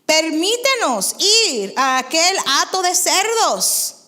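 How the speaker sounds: loud, slow pleading demon voice. Acted. Demons begging Jesus, loud and slow. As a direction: drag out the plea loudly. Preacher voicing demons' plea.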